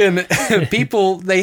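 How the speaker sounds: a trailing laugh, drawn out. Real, not acted. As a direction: still chuckling as you go on. Still laughing after the joke.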